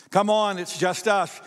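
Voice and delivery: normal talking voice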